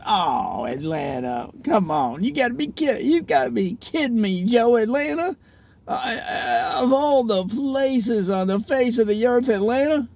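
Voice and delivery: hammed-up incredulity, climbing high; mock disbelief. Imagined person dismissing Atlanta, in mock disbelief. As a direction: scoff in exaggerated, high-pitched disbelief.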